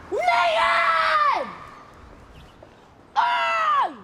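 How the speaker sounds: calling out. Real, not acted. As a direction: yell sharply down the street for attention. Calling to someone far away.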